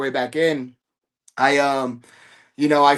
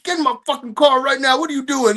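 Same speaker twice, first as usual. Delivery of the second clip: stern dad yell; 8 semitones higher than usual. Retold or imitated. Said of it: "I felt like a dad".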